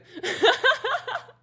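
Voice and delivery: squealing high laugh; a real burst of giggles. Real, not acted. Cook giggles at her own panic, squealing laugh.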